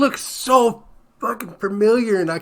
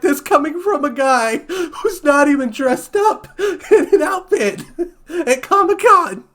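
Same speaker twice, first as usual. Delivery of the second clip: higher and louder, the words bursting through laughter; laughing retort; 9 semitones higher, 3 dB louder than usual. Retold or imitated. Recalling his own Comic-Con comeback, laughing hard. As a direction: fire back the comeback while cracking up.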